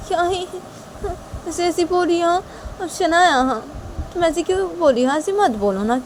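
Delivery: high-pitched, mildly upset questioning; lightly acted worried girl; upset Tisha.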